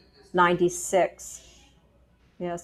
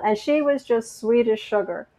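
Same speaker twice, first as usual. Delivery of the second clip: usual speaking voice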